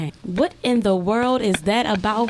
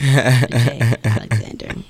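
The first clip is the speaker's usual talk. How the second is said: slow, deep hype-man announcement; hype announcer; 10 semitones lower, 3 dB louder, much slower than usual. Pretend. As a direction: announce him like a big star. Hyping the guest's name.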